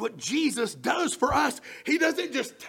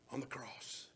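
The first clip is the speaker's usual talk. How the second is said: whisper; 9 semitones lower, 17 dB quieter than usual. Acted. Whispered close.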